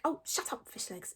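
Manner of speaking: hushed, irritated brush-off; impatient Hiccup. A narrator's reading. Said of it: "said Hiccup impatiently".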